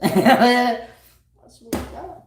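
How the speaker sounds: high, loud laughing oath. Real, not acted. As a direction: swear it while cracking up.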